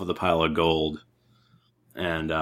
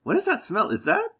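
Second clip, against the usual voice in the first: dainty sniffing voice. Retold or imitated. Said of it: "You guys are like".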